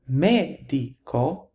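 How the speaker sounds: "medico" slowly, stress on ME; stress third from last. Shown as a demonstration. Teacher demonstrating the stress rule, slow "medico" stressed on ME. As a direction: draw out "medico", leaning on the first syllable. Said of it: "stress falls on the third to the last syllable".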